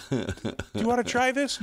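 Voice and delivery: high mock sales pitch; pushy sampler. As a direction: play the eager sample lady. Reenacting the sampler.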